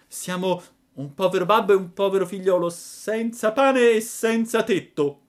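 put-on a poor boy begging at the door; pleading puppet. A narrator's reading. Pinocchio answers at the door.

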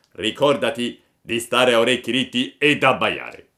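loud accusing farmer voice; angry farmer. A narrator's reading. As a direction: confront the chicken thief. Farmer gives orders.

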